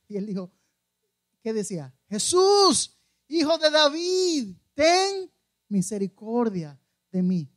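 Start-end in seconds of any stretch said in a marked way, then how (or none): 2.1-7.5 loud, high pleading shout with long drawn words; crying out. Acted. Blind Bartimeo shouts to Jesus as he passes, crying out. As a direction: call out slowly over a crowd, pitched up. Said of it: "A gritar".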